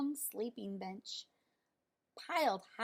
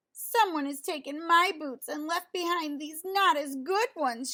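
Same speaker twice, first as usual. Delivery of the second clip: higher, louder whiny wail; Baby Bear wails over her missing boots; 5 semitones higher, 7 dB louder than usual. A narrator's reading. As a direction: wail like a cub who lost her boots. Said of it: "she wailed".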